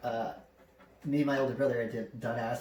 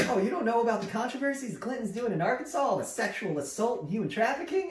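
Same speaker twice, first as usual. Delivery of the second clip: high, quick imitation of an incredulous informer; eager conspiracy theorist; 10 semitones higher than usual. Arkansas stranger telling the family about the Clintons, eager conspiracy theorist. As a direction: imitate someone astonished you haven't heard.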